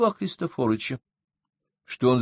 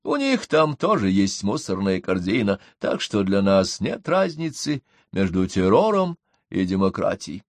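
plain reading voice

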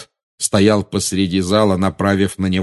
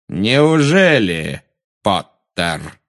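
slow, icy, drawn-out sneer; Voldemort mocking Potter; high cold voice; much slower than usual. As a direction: stretch it out coldly, like a snake. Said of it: "высокий холодный голос".

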